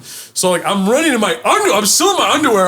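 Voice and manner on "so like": ordinary talk, nothing marked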